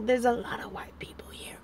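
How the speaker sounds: conspiratorial whisper. Real, not acted. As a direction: whisper it so nobody nearby hears. Cheeky remark about the park.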